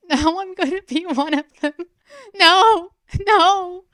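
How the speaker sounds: put-on high, loud girl crying out in horror; panicked cry. A narrator's reading. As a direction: scream no in despair. Girl's panic breaks into cries.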